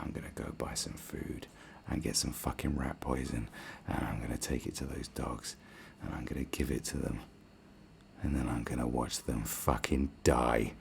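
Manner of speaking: low, soft murderous scheming; quiet vengeful plotting. Retold or imitated. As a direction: plot revenge under your breath, slowing at the end. Retells revenge fantasy in bed.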